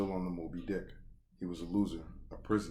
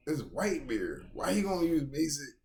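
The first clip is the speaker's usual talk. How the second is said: incredulous fan; 8 semitones higher, 4 dB louder than usual. Retold or imitated. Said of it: "'Cause I'm like".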